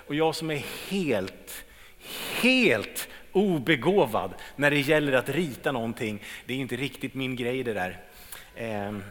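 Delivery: ordinary delivery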